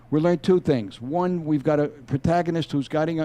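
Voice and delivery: ordinary talk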